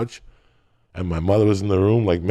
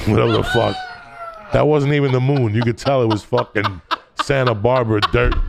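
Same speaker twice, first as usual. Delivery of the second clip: usual speaking voice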